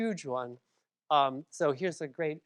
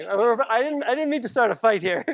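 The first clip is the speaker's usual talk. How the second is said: amused laughing protest; 6 semitones higher, 8 dB louder than usual. Real, not acted.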